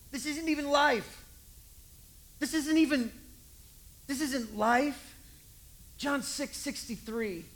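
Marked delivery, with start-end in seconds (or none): 0.1-5.1 emphatic insistence. Acted. Jesus insists.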